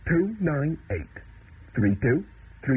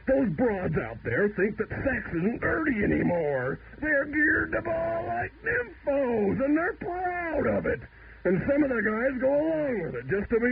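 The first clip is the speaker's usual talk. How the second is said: high-pitched, escalating indignant rant; a mock-outraged crank on his soapbox; worked-up rant; 11 semitones higher than usual. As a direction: get yourself all worked up about the old days. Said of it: "I'm getting much too worked up".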